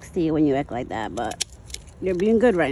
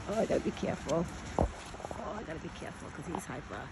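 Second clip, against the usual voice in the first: hushed, low murmur to self; wary aside; 5 semitones lower, 12 dB quieter, faster than usual. Real, not acted. Woman wary of her hyper buck, hushed aside. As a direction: keep it very soft, wary of the animal.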